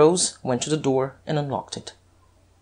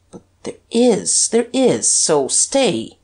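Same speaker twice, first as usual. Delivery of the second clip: higher, insistent plea; urgent cry; 5 semitones higher, slower than usual. A narrator's reading. Detective begging the Duke to stay, urgent cry. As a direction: plead urgently, pitch raised at first. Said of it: "cried Guerchard".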